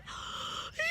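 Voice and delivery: gasp. A narrator's reading. Cat gasps before news.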